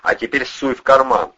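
forceful order; commanding. A narrator's reading. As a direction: boss him through each step. Ordering captive at gunpoint.

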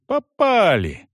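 high excited shout. Acted. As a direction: call out from the wall. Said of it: "крикнул". (громкий радостный выкрик)

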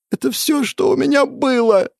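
very high, anguished cry; tearful wail. A narrator's reading. Boy crying over all he had lost, high tearful wail. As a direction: wail it through running tears. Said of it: "дрожащим голосом, продолжая утирать всё бегущие слёзы".

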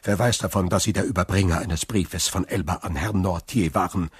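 usual speaking voice

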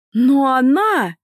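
high, loud, drawn-out objection; protesting. A narrator's reading. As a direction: whine back in loud, stretched-out protest. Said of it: "попыталась возразить Лика". (протестующе, громко и протяжно)

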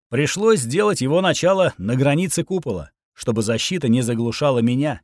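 ordinary delivery, nothing marked

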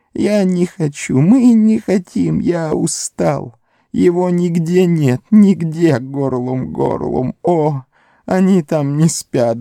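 high, slow snivelling creature; whimpering Gollum. A narrator's reading. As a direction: sob and plead, slow and high. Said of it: "жалобно всхлипывая".

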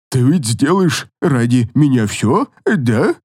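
slow low coaxing voice; a seductive monster wheedling; creepy fairy voice. Acted.

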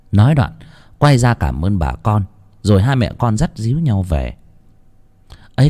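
ordinary delivery

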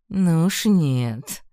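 slow, loud predatory refusal. A narrator's reading. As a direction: draw it out with menace. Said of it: "хищно оскалился начальник дворцовой стражи".

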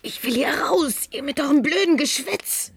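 breathless, frightened whisper; panicked whisper. Acted. Lena whispers to friends in dome panic, terrified. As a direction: choke the words out in panic.

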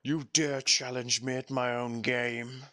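slow, dark mock-villain challenge; menacing villain voice. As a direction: taunt them like a boss monster. Joking about the 'God of War'.

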